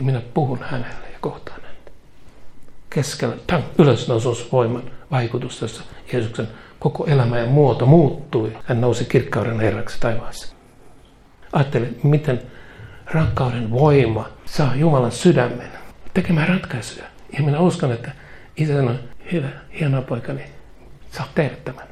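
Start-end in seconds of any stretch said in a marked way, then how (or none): 0.0-1.9 softer, whispered confiding promise; hushed intimate whisper. Acted. Jesus confiding to the Father. 19.3-21.8 tender approval. Acted. Father approving Son, tenderly.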